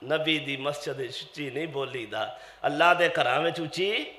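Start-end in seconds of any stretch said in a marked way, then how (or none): 0.0-2.4 low, very soft answer; subdued, lowered preacher's voice; hushed admonition. Real, not acted. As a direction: drop right down to show restraint. Answers own loud question softly.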